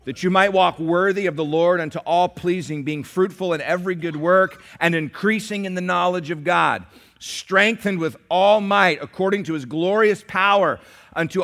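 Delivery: loud, ringing scripture reading; preaching scripture. Acted. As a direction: proclaim the verses to the church.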